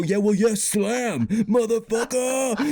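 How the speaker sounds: high, sing-song gloat ending in a mock shout; triumphant comeback. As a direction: crow it like a rapper dropping the mic. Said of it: "I just wanted to go".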